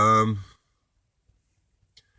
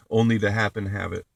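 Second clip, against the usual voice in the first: usual speaking voice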